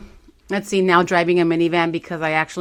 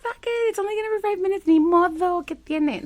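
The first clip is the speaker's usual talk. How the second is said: much higher, careless mock voice; carefree younger self; 13 semitones higher than usual. Her younger self shrugging off wasting pool water, high and careless. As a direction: toss it off like you don't care. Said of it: "Before, I would have been like".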